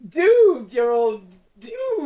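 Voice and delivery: high, loud doom line breaking up; nearly corpsing. Retold or imitated. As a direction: fight back laughter on the doom line. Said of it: "He's nearly corpsing as he says it".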